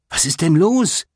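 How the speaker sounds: high-pitched, confused shout; alarmed question. A narrator's reading. June trying to keep up with mascots, calling in confusion. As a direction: call the question after them. Girl running after fleeing mascots.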